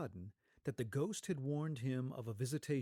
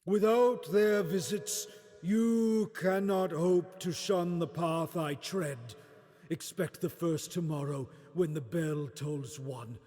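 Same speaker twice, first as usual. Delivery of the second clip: Marley's ghost; 5 semitones higher, 9 dB louder than usual. Acted. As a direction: boom it out like a spirit from the grave. Marley's ghost warns Scrooge.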